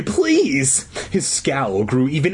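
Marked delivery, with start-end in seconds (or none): 0.0-0.9 small, high-pitched plea; meek little begging voice. Acted. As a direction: add it meekly, pitched up high. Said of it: "I added meekly".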